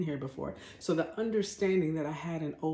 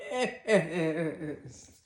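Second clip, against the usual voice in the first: laughing. Real, not acted.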